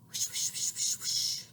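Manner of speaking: soft unvoiced whooshes. Shown as a demonstration. Harold imitating the washing machine.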